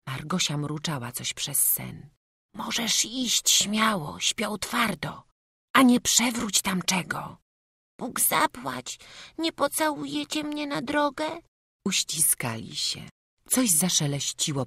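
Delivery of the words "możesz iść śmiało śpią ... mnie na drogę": little boy saying goodbye, voiced high; small boy's farewell. A narrator's reading. Boy thanking his foster mother, lightly voiced high.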